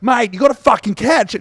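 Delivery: high, loud mock yell at a teammate. Coach blaming a teammate for a dropped pass, loud mock yell. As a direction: bellow it like a frustrated coach. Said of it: "and be like".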